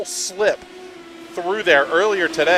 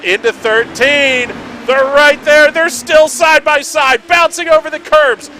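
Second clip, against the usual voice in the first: rising, louder final-lap commentary; excited race call; 9 semitones higher, 7 dB louder than usual. Real, not acted. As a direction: build the call higher up the hill.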